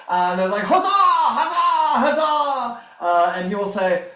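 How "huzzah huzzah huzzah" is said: three high, loud cheers; crowd huzzahs. Acted. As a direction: shout like a celebrating crowd.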